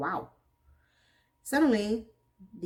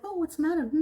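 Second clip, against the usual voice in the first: mock concern; 10 semitones higher, 3 dB louder, faster than usual. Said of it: "Miranda's like".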